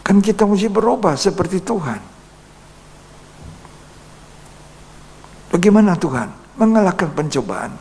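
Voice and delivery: high, loud preaching at full force; fiery preaching. Real, not acted. Preacher urging the congregation to change, preaching loudly.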